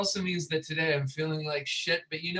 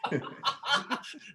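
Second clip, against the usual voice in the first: high breathy laugh; 10 semitones higher than usual. Real, not acted. Laughs at own joke.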